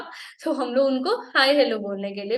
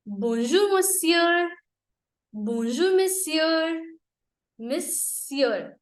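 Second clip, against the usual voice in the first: slow model phrase; 4 semitones higher, much slower than usual. Shown as a demonstration. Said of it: "slow repeated model phrase".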